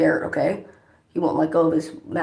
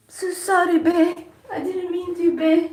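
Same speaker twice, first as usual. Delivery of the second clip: very high-pitched put-on character voice; Rumple impression; 13 semitones higher, slower than usual. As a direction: squeak out a pleading apology in character. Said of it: "That's how he talks".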